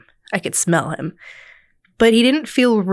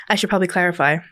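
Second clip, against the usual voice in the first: ordinary talk, nothing marked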